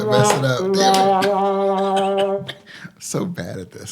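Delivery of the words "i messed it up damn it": high, drawn-out real exasperation; exasperated. Real, not acted. Joke teller owning up to a botched joke, exasperated. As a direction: wail it out slowly, pitch climbing.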